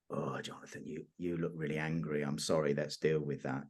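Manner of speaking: soft soothing voice. Shown as a demonstration. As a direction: soothe him quietly and tenderly. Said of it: "If I say".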